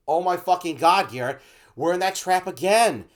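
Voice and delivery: loud, high exasperated outburst, toned down; mild mock fury. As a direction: vent at your partner, half playing it. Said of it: "I'm doing a mild version".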